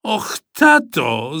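put-on slow, drawn-out childish whine; boy begging dad, slow whiny wail; whining plea.

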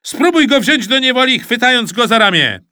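high, loud coaching yell; shouted instructions. A narrator's reading. Supervisor telling Rufus to catch him, shouting. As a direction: urge him on at full voice. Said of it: "krzyknął Rosół".

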